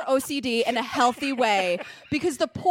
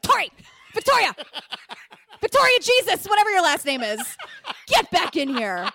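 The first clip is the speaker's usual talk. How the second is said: calling loudly and higher, half laughing; mock mother calling; 4 semitones higher, 3 dB louder than usual. Mother calling Tori in from the rain, mock loud call. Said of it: "her mother's like".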